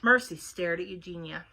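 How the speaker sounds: ordinary delivery, nothing marked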